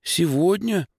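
high, startled question; frightened. A narrator's reading. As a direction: ask back in alarm, voice jumping up. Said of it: "переспросила она испуганно". (испуганно переспрашивая)

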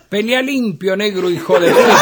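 a rowdy fan's shout at the arena; mock crowd yell. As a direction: yell it like a stadium crowd, laughing through it. Crowd yells at the fight.

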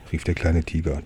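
plain reading voice

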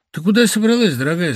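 high, anxious question; worried, calling after his wife. A narrator's reading. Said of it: "спросил он тревожно". (встревоженный вопрос мужа жене)

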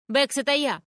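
loud high yell; yelling at full strength. A narrator's reading. Gloria yelling past the mother to Becks, high full-force yell. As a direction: bellow it so she hears from upstairs. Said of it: "Ору изо всех я сил".

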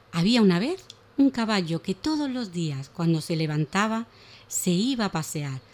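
put-on lively, animated reading of a children's tale; expressive children's story voice; storytelling for children. A narrator's reading. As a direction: tell it like a bedtime story for little ones.

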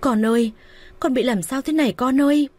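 high wailing cry over her son; anguished cry. A narrator's reading. Mother to her stricken son, high anguished cry. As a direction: call out to the son in despair. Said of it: "Mẹ chồng tôi hét lên".

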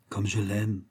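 a murmured lover's confession. A narrator's reading. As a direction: breathe it out dreamily in love. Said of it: "murmura-t-elle".

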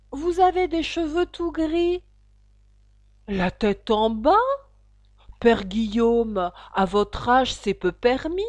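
loud, measured poem declamation; verse recitation. Acted. Alice reciting to the caterpillar, loud verse declamation. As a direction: declaim the verse, drawing out the phrases.

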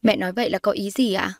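plain reading voice

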